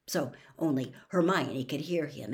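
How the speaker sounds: plain reading voice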